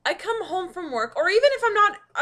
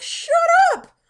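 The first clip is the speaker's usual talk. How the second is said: laughing complaint; 13 semitones higher, 10 dB louder than usual. Retold or imitated. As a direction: whine at the cat through laughter. Groggy retort to cat.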